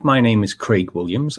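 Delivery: ordinary delivery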